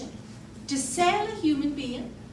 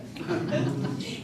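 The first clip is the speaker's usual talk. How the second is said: nervous giggle. Shown as a demonstration. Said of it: "a little giggle there".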